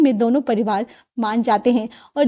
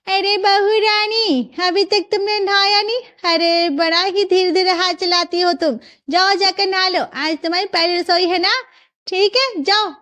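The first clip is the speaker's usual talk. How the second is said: put-on high, fussy old elder; nagging grandmother; 6 semitones higher than usual. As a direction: chivvy the bride along. Elder scolds bride to bathe.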